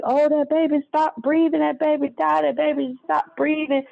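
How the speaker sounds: high-pitched imitation of panicked repetition; retold panic. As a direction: mimic a frantic grandmother raising the alarm. Retells grandmother panicking over baby.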